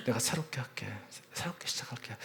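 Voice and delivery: kneeling father whispering a vow; whispered promise. Retold or imitated. Kneeling father begging sons' forgiveness.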